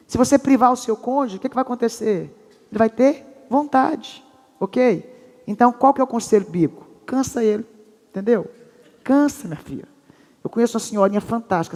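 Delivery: ordinary talk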